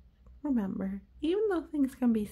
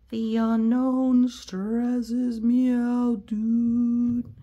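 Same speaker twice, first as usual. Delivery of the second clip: loud, slow, drawn-out worried bird; stressed pigeon; 2 semitones higher, 7 dB louder, much slower than usual. Acted.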